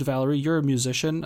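ordinary delivery

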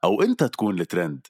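bright commercial voiceover, slowing at the end; ad read. Acted. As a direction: sell it like a radio spot. Reads radio ad script.

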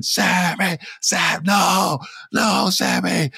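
retold frantic yell. Wrestler yelling at Sami.

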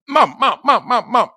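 rapid high repeated calling; pestering kid. Retold or imitated. Kids trying to get mommy hot, pestering. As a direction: nag in a high child's voice.

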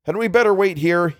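high, tense question; strained, anxious. A narrator's reading. Algernon suggests waiting by the car, strained. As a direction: ask with a voice tight with strain. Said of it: "his voice tight with strain".

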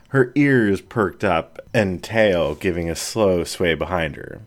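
ordinary delivery

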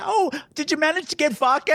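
high-pitched hopeful question; high, lilting imitation; mock eager asking. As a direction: ask it high, imitating her. Acting out her asking for vodka.

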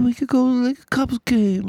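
put-on high squeaky Mexican accent. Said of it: "doing a Mexican accent".

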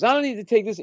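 put-on high, indignant drunk; mock huffy protest; drunk and offended. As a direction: imitate a fed-up drunk heckler. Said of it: "And he's drunk".

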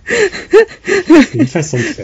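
said through a high laugh; laughing it out slowly. Real, not acted. Laughing at the odd name, laughing.

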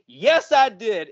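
high, drawn-out mock indignant comeback; retold blow-up. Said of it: "she blows up on me again".

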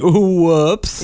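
high, loud mock-innocent oops; imitated boy's whoops. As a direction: fake an accident like a little kid. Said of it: "He's like".

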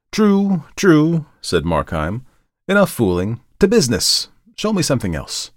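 high, loud and brusque; abrupt, tense. Acted. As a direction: snap back to business. Markheim snaps to business.

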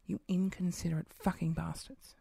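low, hushed swearing under the breath; seething half-whispered insult; muttered cursing. Retold or imitated. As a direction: curse them quietly so they barely hear.

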